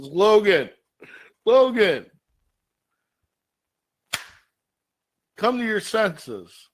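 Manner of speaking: loud, high desperate shouting; pleading shout. Acted. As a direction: yell his name to snap him out of it. George pleading with Wolverine.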